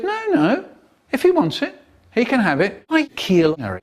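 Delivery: ordinary talk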